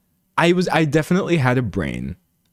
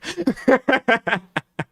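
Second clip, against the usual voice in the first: high-pitched giggle burst; high laugh; 13 semitones higher than usual. Real, not acted. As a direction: crack up high at your own line. Laughs at own joke.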